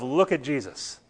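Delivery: quiet, stressing look; soft look at Jesus. Acted. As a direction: say it softly, offered up. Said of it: "respond with the idea of".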